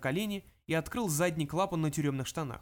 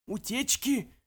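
alarmed question; 8 semitones higher, much slower than usual. Acted. Dubets echoes alarmed.

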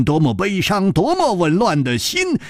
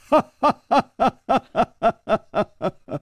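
high, drawn-out wail of grief; wailing sob; 7 semitones higher than usual. Acted. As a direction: let out a long crying wail. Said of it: "他痛哭着说". (嚎啕大哭)

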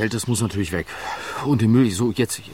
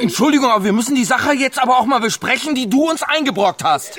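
raised, high and sharp, pushing back hard; indignant outburst; 10 semitones higher than usual. Acted. Woman rounds on her friend, raised and indignant. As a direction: snap back at the one who caused the mess. Heated argument over the dead man.

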